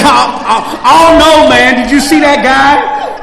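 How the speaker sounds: high, shouted mock disbelief; addict's loud doubt. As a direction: play the addict protesting loudly, dragging out the words. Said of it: "You know what the addict said".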